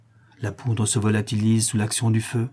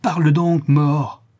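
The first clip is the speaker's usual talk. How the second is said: higher, louder furious demand; beside himself; 5 semitones higher, 5 dB louder, slower than usual. Acted. Disciple shaking his master's corpse, furious and louder. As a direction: shake the words out of a corpse. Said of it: "Emmaüs, hors de lui".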